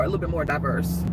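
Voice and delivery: normal talking voice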